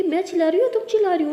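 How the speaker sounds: heated sisterly squabble; quarrelsome shouting. Acted. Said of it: "मेरे ऊपर क्यों चिल्ला रही हो?".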